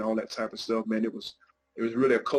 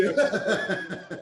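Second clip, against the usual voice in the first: high burst of a laugh on yeah; laughing agreement; 8 semitones higher than usual. Real, not acted. Laughing with host.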